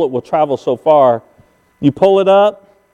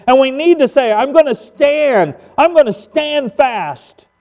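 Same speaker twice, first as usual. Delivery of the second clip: emphatic sermon delivery, climbing higher; fervent preaching; 7 semitones higher than usual. Acted. Pastor warns the church Satan is working, fervent preaching. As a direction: drive each phrase home to the congregation.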